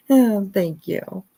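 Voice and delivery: ordinary talk, nothing marked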